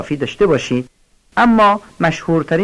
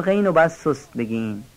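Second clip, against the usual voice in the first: ordinary talk, nothing marked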